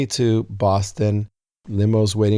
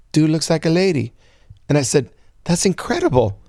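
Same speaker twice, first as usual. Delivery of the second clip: excited; 6 semitones higher than usual. Retold or imitated. Excited reaction to song title.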